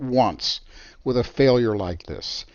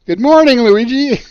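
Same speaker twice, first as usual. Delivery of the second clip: high, loud sing-song hello; mock cheery greeting; 10 semitones higher, 8 dB louder than usual. Retelling Schmo's chirpy greeting, hammed-up cheerful. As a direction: greet him brightly like nothing's wrong.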